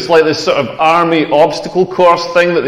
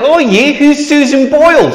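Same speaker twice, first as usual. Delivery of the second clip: higher-pitched mock Australian; Australian accent; 6 semitones higher than usual. Puzzled Australian flatmate asking about a singer, mock Australian accent. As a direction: play the clueless Aussie flatmate, accent on. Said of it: "that's exactly what Australians sound like".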